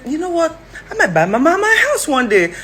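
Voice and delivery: high-pitched, playful daughter voice; imitating her daughter. Acting out her daughter's promise.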